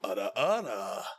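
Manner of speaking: teasing Russian ara ara for a viewer; Russian-accented ara ara. Pretend. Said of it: "get into character for a second".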